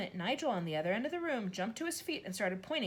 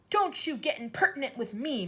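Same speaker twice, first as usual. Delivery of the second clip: higher, louder snap at a teacher; snapped; 7 semitones higher, 7 dB louder than usual. A narrator's reading. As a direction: bite the words off, indignant. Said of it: "the Trunchbull snapped".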